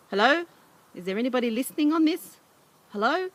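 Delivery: high, loud, urgent calls; calling out hoping someone hears; calling into the mic. A narrator's reading. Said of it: "blew into the mic".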